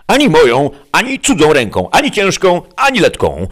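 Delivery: high, insistent list of refusals; emphatic refusal. A narrator's reading. Sancho refusing any hand's lashes, high and emphatic. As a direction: refuse indignantly, each item punched high.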